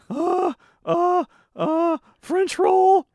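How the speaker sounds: loud, very high, flustered customer; imitated customer. Flustered customer picking a bread, very high mock voice. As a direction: stammer in a squeaky high voice, drawn out. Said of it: "And they would say".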